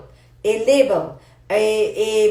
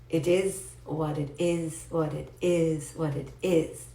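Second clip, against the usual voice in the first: slow calm mantra; 5 semitones lower, 7 dB quieter, slower than usual. Shown as a demonstration. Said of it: "slow teaching phrase".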